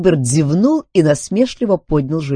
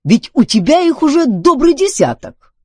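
high, loud protest; exclaiming; 7 dB louder than usual. A narrator's reading. Duncan argues Filbert has bodies enough, exclaiming. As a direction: object with pitch way up. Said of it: "воскликнул Дункан".

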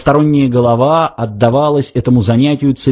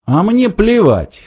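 cold dismissal, pitched high and drawn out; cold, curt; 8 semitones higher, slower than usual. A narrator's reading. Arthur snubs Ford's boast, coldly dismissive. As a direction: shut him down coldly. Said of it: "холодно отрезал Артур".

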